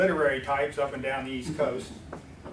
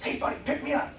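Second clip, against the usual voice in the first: frog voice; 9 semitones higher, 3 dB quieter, faster than usual. Acted. Talking frog in a joke.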